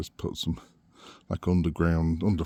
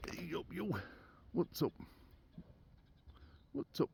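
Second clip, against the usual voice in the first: pet talk; 9 semitones higher, 15 dB quieter than usual. Real, not acted. Greeting the pig softly.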